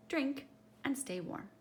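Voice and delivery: usual speaking voice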